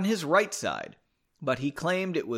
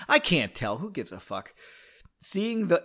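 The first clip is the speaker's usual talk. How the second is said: usual speaking voice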